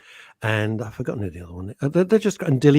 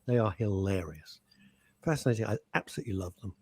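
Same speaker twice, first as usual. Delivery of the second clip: whisper; 5 semitones lower, 8 dB quieter, faster than usual. Real, not acted. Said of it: "whispered aside".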